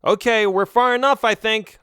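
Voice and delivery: high, loud reply over a distance; yelling back. Acted. Trapped friend answers Melvin before the blast, yelling back. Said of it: "I yelled back".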